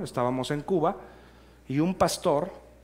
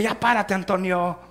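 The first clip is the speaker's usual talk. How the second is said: higher-pitched call to get up; mock wake-up call; 5 semitones higher than usual. Pastor rousing young Antonio from bed, mock wake-up call. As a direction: imitate a pushy roommate rousing someone. Pastor waking him with a slap.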